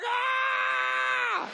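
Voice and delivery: long, very high shriek; imitated passengers' freak-out scream; drawn-out high "aah" of panic; mock panicked scream. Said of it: "everyone is freaking out".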